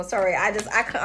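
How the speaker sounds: normal talking voice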